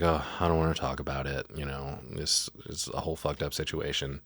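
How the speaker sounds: low, soft, imitated weary phone talk; weary mother. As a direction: mumble it low, as if worn out. Voicing exhausted mother on the phone.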